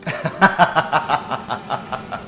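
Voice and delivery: laughing. Real, not acted. Laughing aside about viewers.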